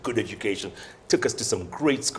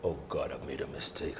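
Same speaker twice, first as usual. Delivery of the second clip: worried murmur; 8 semitones lower, 12 dB quieter than usual. Retold or imitated. Fearing he'd be fired.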